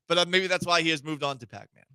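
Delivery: ordinary talk, nothing marked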